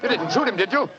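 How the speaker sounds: the shot just went off, demand an answer now; alarmed. Acted. Holmes, shocked by the gunshot, questions Watson in alarm.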